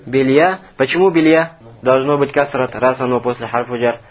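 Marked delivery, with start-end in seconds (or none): none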